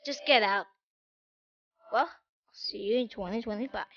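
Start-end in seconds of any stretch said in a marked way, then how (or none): none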